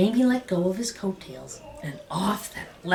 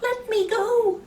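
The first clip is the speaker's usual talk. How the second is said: high, squeaky shout; little man yelling to be freed; shrill leprechaun shout. A narrator's reading. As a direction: caught leprechaun crying out high. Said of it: "the leprechaun shouted".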